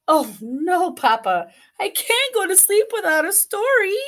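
put-on high, pleading child chick; pleading chicken. Chicken begging Papa for story, high pleading.

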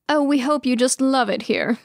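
wistful sigh. A narrator's reading. Mama welcomes the bride to her room, wistful sigh. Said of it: "Mama sighs wistfully".

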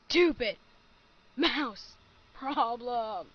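slow, loud, fed-up complaint; fed up with a broken mouse, loud and slow; frustrated. Real, not acted. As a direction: spit out each word in real annoyance. Ending episode out of frustration.

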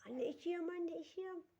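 high-pitched mimicry of a cold child; imitating shivering child. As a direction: playfully mimic a child shivering and calling mum. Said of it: "öyle yapıyorsun ama titriyorsun".

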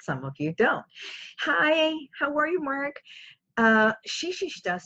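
usual speaking voice

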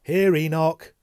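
high, loud summoning call. A narrator's reading. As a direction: call him like a pet to come. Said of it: "I called to him".

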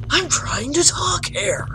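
slow hushed whispered scolding; whispering at a crying ghost. Real, not acted.

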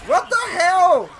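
frantic high yells for help; man down shout. Real, not acted. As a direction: yell at teammates in panic. Teammate down, yelling for help.

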